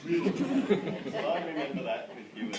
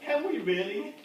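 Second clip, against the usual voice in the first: mock TV producer; 8 semitones higher, 5 dB louder than usual. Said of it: "I can just hear them now saying".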